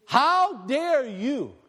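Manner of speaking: Jesus rebuking the money changers, slow and stern; stern rebuke. Retold or imitated. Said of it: "that's exactly what Yeshua did".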